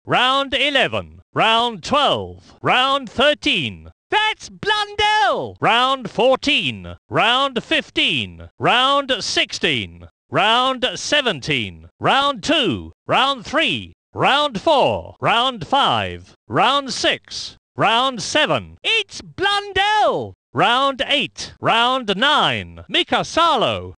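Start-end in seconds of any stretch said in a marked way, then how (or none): 4.1-5.6 high, drawn-out shout of recognition; excited race call. Acted. Commentator spotting Blundell on track, high excited call. Commentator announces a leading driver. 18.8-20.4 high, drawn-out exclamation; excited race call. Acted. Commentator hailing Blundell, high excited call. Commentator announces a leading driver.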